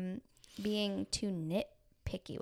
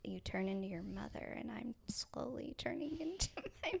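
whispered, half-laughing admission; rueful whisper; 10 dB quieter than usual. Real, not acted. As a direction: confess it under your breath. Rueful whisper about mothers.

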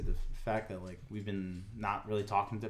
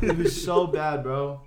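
slow laughing words, higher and louder; laughing slowly; 4 semitones higher, 6 dB louder, slower than usual. Real, not acted. Laughing at the story with friends on the podcast. Laughing retelling a bad moment.

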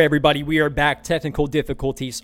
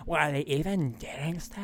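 Valley Girl mock; 8 dB quieter than usual. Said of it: "and you're like".

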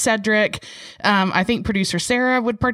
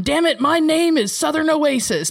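higher, mock-stubborn declaration; mock insistence; 8 semitones higher than usual. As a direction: play a stubborn student staking a claim. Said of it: "I'm not walking in there going".

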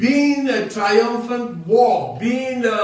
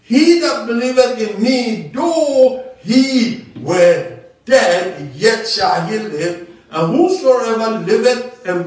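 louder, forceful preaching of scripture; 3 semitones higher, 5 dB louder than usual. Acted. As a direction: proclaim it to the congregation.

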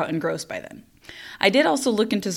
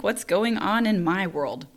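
ordinary talk

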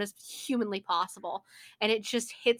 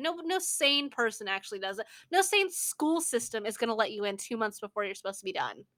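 normal talking voice

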